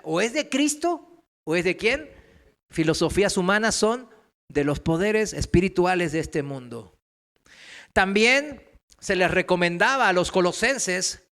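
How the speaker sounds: normal talking voice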